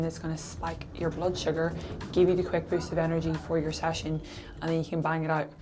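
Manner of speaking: ordinary talk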